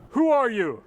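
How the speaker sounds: shouting. Acted. Shouting demand.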